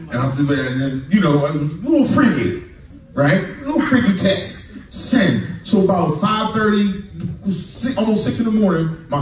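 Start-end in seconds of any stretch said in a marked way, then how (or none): none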